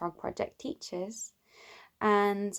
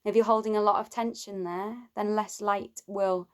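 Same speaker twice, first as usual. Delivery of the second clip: guided meditation. Acted. Teacher leads listeners towards love for oneself, calm guided meditation. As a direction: guide them gently inward, leaving space between phrases.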